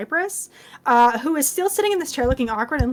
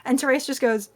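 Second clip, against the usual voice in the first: ordinary talk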